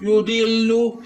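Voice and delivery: युदिल्लु with a thick, heavy d; युदिल्लु said with the d sound thickened. Shown as a demonstration. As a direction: make the d in युदिल्लु full and heavy. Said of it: "इसको थोड़ा सा मोटा करें".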